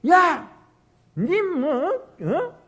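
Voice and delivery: high, loud mock dressing-down; badgering scolding. Preacher acting out scolding someone weighed down by worry, hectoring. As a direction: round on them, hectoring. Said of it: "하면서 막 그렇게 다그쳐야만 되는가".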